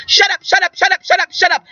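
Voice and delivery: high, quick repeated shut up; demonstrated yell. Shown as a demonstration. Teacher demonstrates quieting mind chatter, yelled out loud. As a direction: call it out in a high-pitched burst. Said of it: "yell out loud, as loud as you can".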